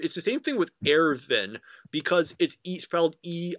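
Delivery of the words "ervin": Erwin with the W said as a V; German pronunciation. Shown as a demonstration. Explaining German names to a co-host, Erwin with a V sound. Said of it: "W is pronounced as a V sound".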